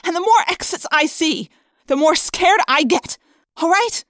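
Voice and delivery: high, loud, frightened rant; distraught outburst. A narrator's reading. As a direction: pour out the fear at full pitch.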